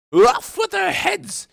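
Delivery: very high, loud, drawn-out shriek; screaming queen. Acted. Queen sentencing the gardeners, furious scream. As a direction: scream the order at full force.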